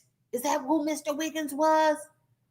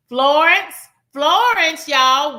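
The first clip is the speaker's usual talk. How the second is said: excited recall; 3 semitones higher, 8 dB louder, slower than usual. Real, not acted.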